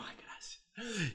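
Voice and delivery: soft grateful. Retold or imitated. Quoting own grateful reply.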